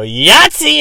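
high, loud victory yell; friend's guy yelling at climax; triumphant shout. Retold or imitated. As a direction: whoop it like a winner. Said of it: "he would go".

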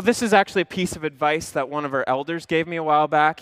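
usual speaking voice

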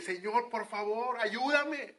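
high pleading prayer, rising to ayúdame; crying out. Shown as a demonstration. Believer alone in the room pleading with God for help. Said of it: "en tu habitación decir".

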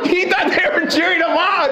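high emphatic punchline; excited emphasis. Real, not acted. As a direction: hammer the reveal. Punchline repeated.

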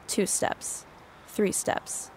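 soft whisper, counting steps. Acted.

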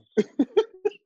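real high-pitched laugh. Real, not acted. Host amused about slandering Coop, laughing. Laughing at his own admission.

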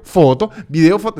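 stubborn loud haggling over foto and video; heated insisting. Retold or imitated. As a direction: shove the words at him. Shoving phone away during argument.

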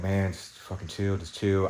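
low, soft talk to himself; retold self-calming. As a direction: quietly talk yourself down. Retells talking himself down.

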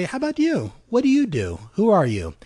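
finally curious back; interested question. Pretend. Sarah asking the newcomer about himself, lifted and interested.

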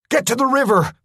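high desperate scream over gunfire. Acted. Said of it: "I know I screamed the words".

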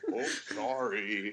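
slow, soft fake apology; mock sheepish apology. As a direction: say sorry with false innocence. Mock apology after hitting guys.

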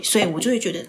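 ordinary delivery

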